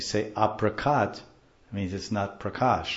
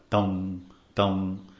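spaced, resonant "dum" thumps; imitated stick thuds. Teacher imitating the stick on morning walk tapes, measured thuds. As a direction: voice each knock of a walking stick. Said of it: "His stick is hitting the ground".

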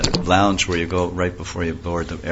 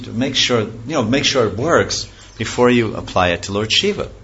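put-on higher, sweet little boy voice; sweet child Krishna; 5 semitones higher, 4 dB louder than usual. Little boy Krishna teases the demon, sweet and higher. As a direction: coax him like an innocent child. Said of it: "in a very sweet voice and a high-pitched voice of a child".

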